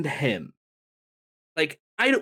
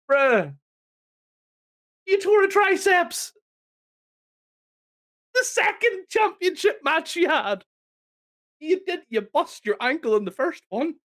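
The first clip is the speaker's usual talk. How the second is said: exasperated rant; 12 semitones higher than usual. Real, not acted. Ranting at Punk.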